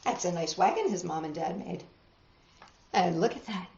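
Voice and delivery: plain reading voice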